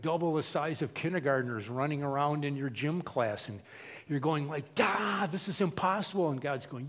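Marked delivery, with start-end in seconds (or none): 4.7-6.3 mildly exasperated outburst, pitched up; frustrated groan into words. Acted. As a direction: throw up your hands at an impossible job. Acts a frustrated gym teacher.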